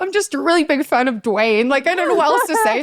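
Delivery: high, mock-innocent excuse; put-on high, overwhelmed fib; flustered cover-up. Retold flustered cover story to cousin.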